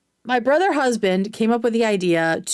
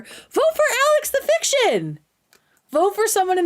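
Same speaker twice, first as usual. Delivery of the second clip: much higher, squeaky pleading voice; hammed-up plea; 13 semitones higher than usual. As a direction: plead for your friend in a cartoonish squeak.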